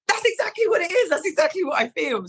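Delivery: high-pitched eager quote; excitedly seizing on the diagnosis; excited recognition. Retold or imitated. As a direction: act out finally being understood, pitched well up. Acts out relieved recognition at doctor's.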